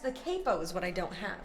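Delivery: normal talking voice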